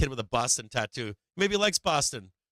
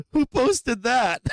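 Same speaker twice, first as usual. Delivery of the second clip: loud, high, drawn-out question through laughter; real laughing; 9 semitones higher, 6 dB louder, much slower than usual. Real, not acted. Streamer reacting to a Discord post, really laughing and loud. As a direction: crack up while demanding an answer.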